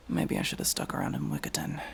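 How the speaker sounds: normal talking voice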